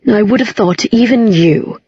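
measured, deliberate delivery; said slowly. A narrator's reading. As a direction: say it deliberately, only slightly slowed. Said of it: "he said slowly".

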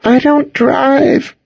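high, slow, tearful quiver. A narrator's reading. Frightened friend refusing to drive, voice quivering. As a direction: let it wobble with fear. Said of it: "her voice quivering".

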